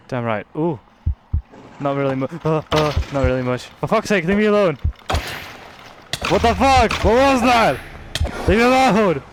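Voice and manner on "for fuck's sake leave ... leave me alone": high, loud frightened shouts; panicked yelling. Real, not acted. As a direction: yell at the thing chasing you. Panicking at a monster in game.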